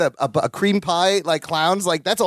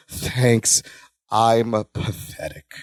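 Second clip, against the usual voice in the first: low, slow, mock self-pity; pathetic mope; 9 semitones lower, much slower than usual. [name] cuddling Dimitri, low slow self-pity. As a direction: sink the voice low and drag it out pitifully.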